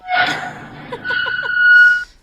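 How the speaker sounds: sudden high cackling laugh; loud burst of laughter. Real, not acted.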